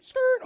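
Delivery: sudden loud, high skidding noise; imitated screech. Preacher mimes being stopped short by friend's rebuke, imitated screech. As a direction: squeal like brakes slamming on.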